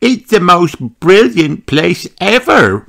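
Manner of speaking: high, lively piglet voice; Peppa. A narrator's reading. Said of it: "replied Peppa".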